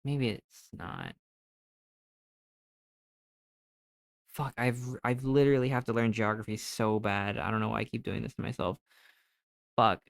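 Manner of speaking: soft and fast, trailing away; defeated mumbling. Real, not acted. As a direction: mutter to yourself, beaten. Defeated muttering after failing.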